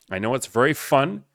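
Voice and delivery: ordinary delivery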